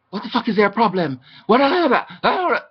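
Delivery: hammed-up aggressive yelling; boy yelling at her in the TV room, imitated shout; imitating a shout. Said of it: "Just shouted at me".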